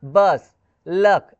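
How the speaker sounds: बस, लक said very slowly with a short "uh"; slow, careful demo of बस and लक; pronunciation demo. Shown as a demonstration. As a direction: stretch out बस, लक to show the short "uh". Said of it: "short यू".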